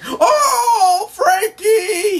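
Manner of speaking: very high, loud squeal of awe; Luffy starstruck. Acted. Luffy sees Franky's new look, starstruck squeal. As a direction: squeal at your idol.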